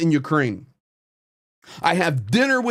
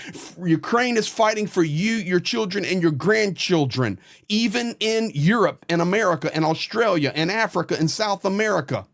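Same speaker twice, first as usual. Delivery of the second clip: usual speaking voice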